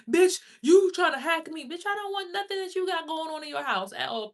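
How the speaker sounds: high-pitched angry holler; outraged shouted retort. Acted. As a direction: yell back at an accuser, stretching it out. Acted retort to accusing onlookers.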